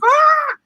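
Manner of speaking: high, loud squeal; mock scream. Girl on a bicycle screaming at Venom, short mock scream. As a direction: shriek in fright. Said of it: "She's like".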